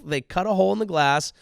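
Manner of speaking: ordinary delivery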